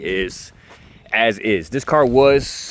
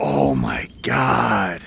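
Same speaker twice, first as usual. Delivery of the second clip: drawn-out, lower disbelief; stunned slow gasp; 6 semitones lower, much slower than usual. Acted. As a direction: stretch it out, stunned. Hears the low mileage.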